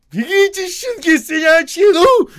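very high, loud angry shout; angry yell. A narrator's reading. Osip angrily yelling at the men to go. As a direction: curse them onward, yelling.

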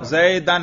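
"zaydan", زیدن with an a in the last syllable; accusative ending. Shown as a demonstration. Teacher asking which case was read, زیدن pronounced "zaydan". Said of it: "نصب".

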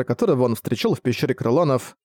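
plain reading voice

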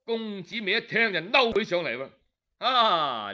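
ordinary delivery, nothing marked

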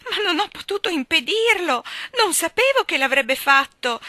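high loud embarrassed cry; shrieking. A narrator's reading. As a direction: shriek it, blushing and caught out. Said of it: "strillò Dora diventando paonazza".

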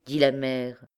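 usual speaking voice

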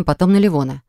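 plain reading voice

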